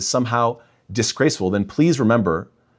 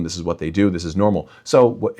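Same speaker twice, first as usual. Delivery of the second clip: usual speaking voice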